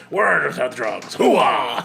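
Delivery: slow, loud bark ending in a yell; a hammed-up Pacino Hooah; Al Pacino impression. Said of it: "like, Al Pacino".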